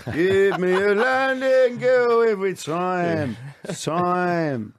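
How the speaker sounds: posh men putting on a hammed-up Cockney; mock Cockney. Posh pop duo slipping into their stage Cockney. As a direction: ham up a fake Cockney accent. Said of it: "let's put on the old Cockney act then".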